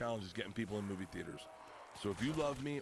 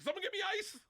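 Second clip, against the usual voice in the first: much higher frantic call; mock desperate cry; faster than usual. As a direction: yelp for help, hammed-up.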